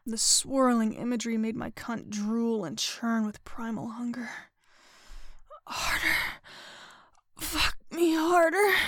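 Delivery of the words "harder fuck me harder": high, drawn-out gasped demand; urgent, breath-caught begging; gasping plea. A narrator's reading. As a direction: plead in a raised, gasping voice. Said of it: "I gasped".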